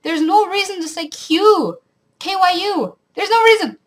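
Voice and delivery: rising indignation over a word; indignant outburst; exasperated rant. Real, not acted. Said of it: "I really dislike".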